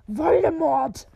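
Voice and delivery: drawn-out, loud, deliberate Voldemort; bold loud name. Acted. Dumbledore defiantly calls out the dreaded name, loud and slow. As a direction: proclaim the forbidden name out loud, slowly.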